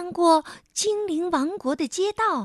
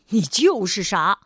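low, louder, stern snap; sharp retort; 5 semitones lower, 5 dB louder than usual. Acted. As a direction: cut her off harshly. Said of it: "傲慢先生厉声说道". (厉声低喝)

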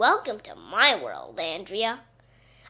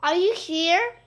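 high, raised questioning call; 7 semitones higher, 4 dB louder than usual. A narrator's reading. Teacher looking for Miss Small, calling out. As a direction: call out to see who is there. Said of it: "called Miss Stacy".

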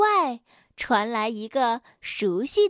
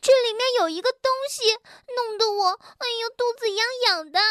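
high, fast, flustered complaint; squirming with itch; 9 semitones higher, faster than usual. Acted. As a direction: gabble it high as if being tickled. Itchy, struggling inside costume. (痒得慌乱地急促叫嚷)

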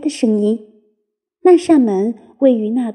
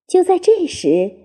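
plain reading voice

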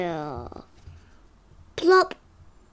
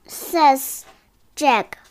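ordinary delivery

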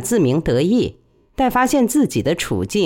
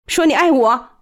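high, sharp command; 9 semitones higher, 3 dB louder than usual. A narrator's reading. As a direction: order him to say it. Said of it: "他命令道".

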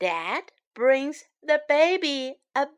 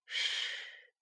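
long, very soft "shh"; soft hushing shh; 15 dB quieter than usual. Acted. Storyteller hushes listeners so baby sleeps, soft shh. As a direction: hush gently, all breath.

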